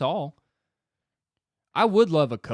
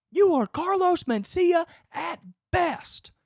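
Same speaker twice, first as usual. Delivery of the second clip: tough coach. Pretend. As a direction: berate him like a drill sergeant coach. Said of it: "a guy hovering over me just being like".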